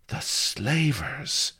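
slow, high aghast gasp; horrified princess. A narrator's reading. Princess recoiling at the slavers, high aghast. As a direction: recoil at the word.

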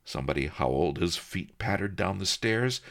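usual speaking voice

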